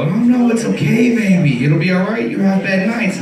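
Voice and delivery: a gentle mock mom comforting her son; imitating a comforting mom. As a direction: coo it like a sweet mother. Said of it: "you would expect to go".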